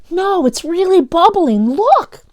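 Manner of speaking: put-on high loud frightened kid; panicked yell. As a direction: yell to make him look. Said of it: "Daniel yelled".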